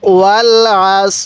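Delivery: slow, drawn-out chanted tilawat; Quran recitation. Acted. Teacher recites the surah for revision, slow chant. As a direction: recite it with long measured vowels.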